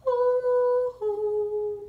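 high, loud hooting; sung owl hoo for the children. Acted. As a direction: hoot it like a baby owl. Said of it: "he used his owl voice".

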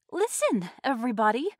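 high, loud plea for attention; urgent appeal. A narrator's reading. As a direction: call everyone to listen. Appealing to people around her.

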